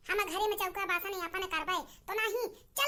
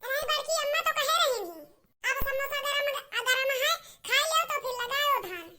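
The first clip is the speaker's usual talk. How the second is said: put-on high, raised voice of a child calling out; child calling mother; 5 semitones higher, 3 dB louder than usual. Child rousing mother to plant paddy, calling high. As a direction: call her up from bed, high and eager. Child urging aunt to eat.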